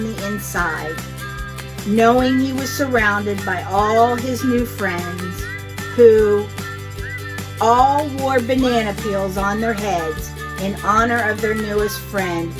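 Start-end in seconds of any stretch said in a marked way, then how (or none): none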